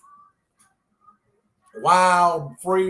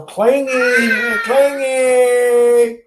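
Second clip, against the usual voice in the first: put-on baby voice; 8 semitones higher, 9 dB louder, much slower than usual. Baby voice to grandchild.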